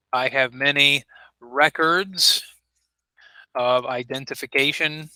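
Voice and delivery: 「records」の頭を強く、ゆっくり; slow example sentence. Shown as a demonstration. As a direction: read it slowly, stressing REcords. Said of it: "we would emphasize the beginning of the word".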